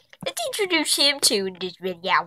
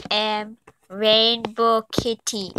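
slow proud announcement; much slower than usual. Pretend. Announcing her character name.

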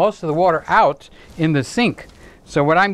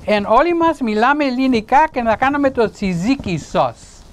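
playful fake Greek-sounding babble, higher; mock Greek gibberish; 5 semitones higher than usual. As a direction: rattle off pretend Greek words like a joke.

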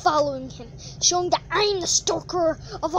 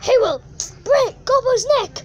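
hype-man yell; 5 semitones higher than usual. Pretend. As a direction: hype the monster like a manager. Hyping Brock's threats.

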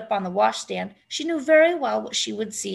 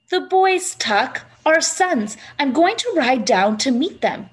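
eager; 4 semitones higher, 6 dB louder than usual. A narrator's reading. Eager wife explains plan.

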